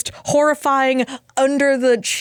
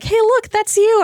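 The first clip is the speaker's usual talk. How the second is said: put-on doctor talking to child; 11 semitones higher, slower than usual. Doctor showing a patient the camera view, as if to a child. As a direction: talk down to a seven-year-old. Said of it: "apparently they think I'm seven".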